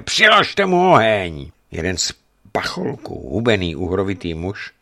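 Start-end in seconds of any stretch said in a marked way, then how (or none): 0.0-1.5 higher, louder and slow, every word hammered; furious order. Acted. Governor orders his men to burn the prisoner, furious shout. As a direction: rage at the silent prisoner.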